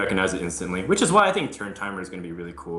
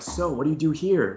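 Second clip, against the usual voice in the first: higher, inquisitive quoted question; playful curious interviewer; 8 semitones higher than usual. Retold or imitated. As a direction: play the eager co-caster asking. Acting out casting beside expert.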